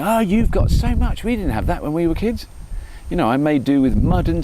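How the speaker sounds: high-pitched mock parental lament; nagging parent. Dad telling his children they have it easy, mock nagging. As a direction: draw it out high, like a parent complaining. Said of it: "all I do with my children is go".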